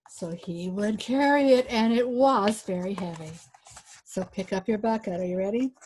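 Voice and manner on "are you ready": usual speaking voice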